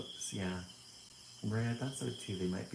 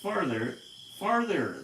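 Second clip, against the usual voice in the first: louder, rising calls into the distance; whale calling; 8 semitones higher, 7 dB louder, slower than usual. Acted. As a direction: call the fish away from afar. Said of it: "the whale called".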